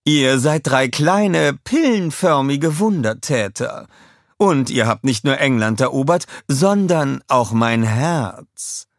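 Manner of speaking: high, slow, gushing praise; lavish praise, stretching the words; public speech. A narrator's reading. Scarlett praising her Minions at press conference, gushing speech. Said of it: "sagte sie zu den Reportern".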